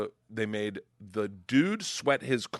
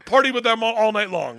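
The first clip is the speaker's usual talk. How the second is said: ordinary delivery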